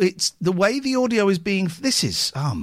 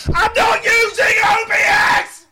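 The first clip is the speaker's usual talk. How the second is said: angry shout; 6 dB louder than usual. Real, not acted. Frustrated streamer yelling at chat.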